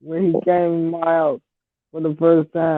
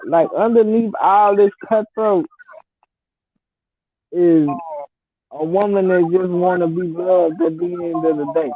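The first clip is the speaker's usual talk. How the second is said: usual speaking voice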